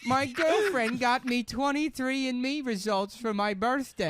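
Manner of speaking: very high mocking caricature; Cockfield. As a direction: play him high-pitched and hammed-up. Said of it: "I'm doing an accurate impression".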